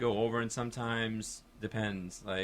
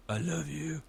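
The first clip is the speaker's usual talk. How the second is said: higher-pitched, sappy lilt; 6 semitones higher than usual. Shown as a demonstration. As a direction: gush it sweetly, sappily. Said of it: "I get all sappy".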